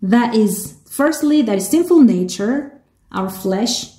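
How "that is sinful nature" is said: ordinary talk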